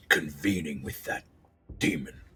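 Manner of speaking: contained menace from an accuser; snarl. Acted. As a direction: snarl it under your voice, pitched low. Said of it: "one of them snarls".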